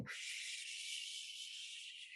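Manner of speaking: soft breathy whoosh of wind; wind sound. Acted. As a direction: blow it out like a gust. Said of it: "the wind would be saying".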